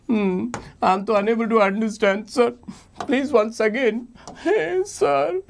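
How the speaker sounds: high-pitched pleading pupil; hammed-up, high, whimpering confusion; mock crying student. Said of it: "don't cry".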